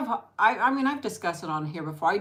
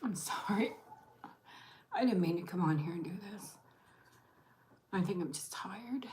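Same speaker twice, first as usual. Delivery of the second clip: holding back tears; 2 semitones higher, 7 dB quieter than usual. Real, not acted. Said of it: "I don't wanna cry on here".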